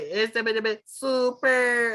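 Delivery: high, drawn-out anime voice imitation; mocking a too-light voice actor; too-light cartoon voice. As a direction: mimic a light-voiced character's catchphrase, stretched out. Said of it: "His voice is too light".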